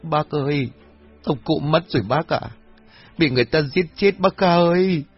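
high-pitched tearful wail; choked sobbing. A narrator's reading. Daughter tells village head her father was killed, sobbing. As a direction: draw out the last cry in anguish. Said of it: "Cô Thủy liền nấc lên".

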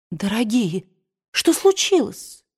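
rising, startled question; alarmed. A narrator's reading. Melanie runs in to the crying women, alarmed. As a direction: rush in alarmed.

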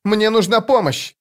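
urgent high-pitched cry, little added volume. A narrator's reading. Youth brings friends news of a kidnapping, crying out. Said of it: "закричал он".

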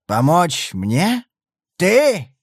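scoffing, slow then sharp; irritated snort. A narrator's reading. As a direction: sneer it in disbelief. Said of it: "раздражённо фыркнул он".

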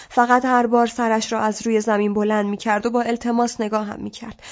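usual speaking voice

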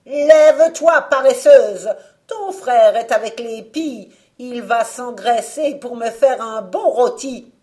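loud, high, savage witch barking orders; fierce witch voice. Acted. Witch ordering Marguerite out of bed, fierce shout. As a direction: snarl it like a cruel witch waking her slave. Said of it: "d'une voix farouche".

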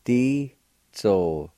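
Di-zo-to-ti drawn out slowly, each syllable separate; slow careful model of Di-zo-to-ti; slow demonstration. Shown as a demonstration. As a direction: say Di-zo-to-ti slowly for the learner.

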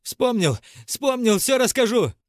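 отчаянный вопль, высоко и громко; вопить в панике, нарастая; истошный вопль. A narrator's reading. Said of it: "истошно завопил". (истошно вопя)

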